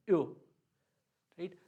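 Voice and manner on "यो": यो as one quick ee-o syllable; O with umlaut. Shown as a demonstration. Teacher contrasting dotted with plain O, quick glide. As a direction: glide fast into the o of यो. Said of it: "ये ई बहुत जल्दी जाएगा".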